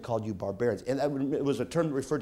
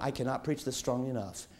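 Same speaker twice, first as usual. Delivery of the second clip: ordinary talk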